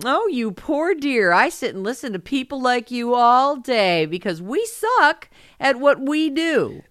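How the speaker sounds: slow, high, patronizing mock sympathy; syrupy fake pity, slowed right down; mock condescension. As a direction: imitate a condescending clerk, drawn out and high. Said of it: "you can tell you're being condescended to".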